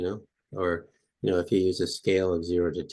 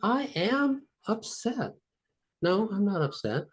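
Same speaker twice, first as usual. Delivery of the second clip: exaggerated back-and-forth; 12 semitones higher than usual. Shown as a demonstration. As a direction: argue with yourself in a high mock voice. High-voiced acted exercise continues.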